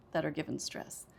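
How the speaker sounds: usual speaking voice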